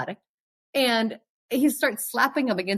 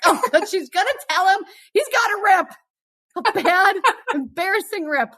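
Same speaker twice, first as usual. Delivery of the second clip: high, loud, half-shouted relief; excited relief; 9 semitones higher, 3 dB louder than usual. Retold or imitated. Thinking friend will mention the rip, high loud relief. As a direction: blurt it out thinking rescue is coming.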